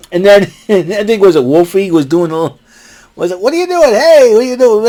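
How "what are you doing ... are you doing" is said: mock kid yelling. Voicing kids shouting at Stokely.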